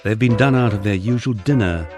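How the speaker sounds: usual speaking voice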